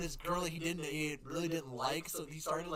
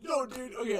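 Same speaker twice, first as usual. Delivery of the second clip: ordinary talk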